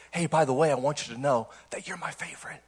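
grandma's secret whispered into his ear; imitated whisper. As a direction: breathe it softly like a secret. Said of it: "she whispered in my ear".

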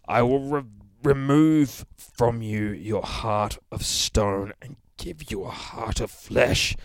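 slow, loud, portentous voice from beyond; ominous reading. Acted. Reading the comic's haunting line, slow and loud. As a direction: intone it like a prophecy.

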